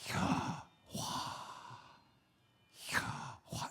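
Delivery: Yahweh as pure unvoiced breathing. Shown as a demonstration. Preacher demonstrating the scholar's theory, name said as breath. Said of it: "like a breath".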